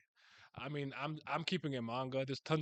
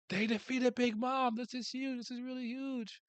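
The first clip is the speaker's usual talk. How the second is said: excited; 10 semitones higher than usual. Real, not acted. Hyped reading of the victory.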